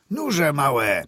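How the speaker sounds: high, loud, merry shout to horses; cheerful call. A narrator's reading. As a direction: urge the horses on merrily. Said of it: "zawołał wesoło na konie".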